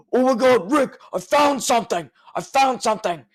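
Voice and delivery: high, loud hammed-up cry of discovery; mock excitement. Imitating Marty yelling to Rick about a find. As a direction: shout it like striking treasure. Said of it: "all you hear is Marty, he's like".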